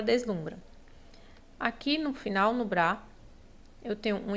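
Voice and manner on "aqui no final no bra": normal talking voice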